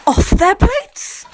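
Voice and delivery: slow, high, loud stretch; slow high loud drawn-out line. Pretend. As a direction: stretch every word. Stretched phrase.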